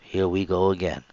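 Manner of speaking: soft to myself. Retold or imitated. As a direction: say it quietly to yourself. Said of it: "as I spoke softly to myself".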